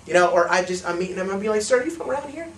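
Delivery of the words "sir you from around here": high, rising eager question; acted excitement. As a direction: greet a stranger with put-on delight. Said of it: "Get excited".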